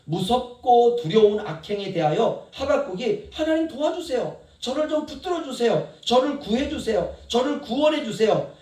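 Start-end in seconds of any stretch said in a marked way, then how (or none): 3.3-8.5 higher, pleading cries for help; Habakkuk's pleading prayer. Acted. Preacher voicing Habakkuk begging God for rescue, pleading. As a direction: beg God to save you. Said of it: "이렇게 하나님께 기도를 하지만".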